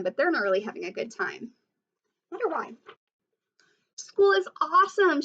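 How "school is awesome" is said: loud, high excited shout. A narrator's reading. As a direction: belt it out like an eager shark. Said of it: "shouted Clark the Shark".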